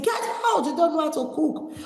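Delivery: high-pitched mock shout of dismissal; imitated angry mother. Mother throwing daughter out of the kitchen, imitated angry shout. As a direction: order her out, pitch leaping up, without much extra volume.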